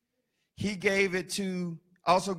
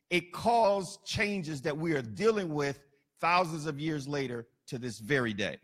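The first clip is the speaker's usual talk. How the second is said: usual speaking voice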